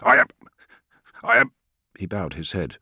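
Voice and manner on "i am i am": high, loud broken-off denial; hysterical protest. A narrator's reading. Friedish denies being hysterical to Call, hysterical. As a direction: burst out, then falter over the words. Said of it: "You're being hysterical".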